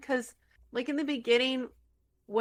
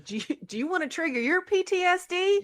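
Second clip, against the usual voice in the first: higher, louder cheesy announcer pitch; mock TV ad; 9 semitones higher, 6 dB louder than usual. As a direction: sell it like a carnival commercial. Parody amusement park ad.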